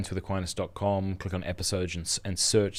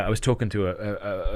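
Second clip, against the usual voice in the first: ordinary delivery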